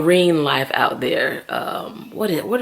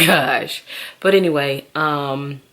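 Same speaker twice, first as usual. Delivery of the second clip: ordinary talk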